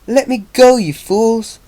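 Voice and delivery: high, loud posh outcry. A narrator's reading. Captive demands the fools let go, posh and loud. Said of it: "Spike heard a posh voice say".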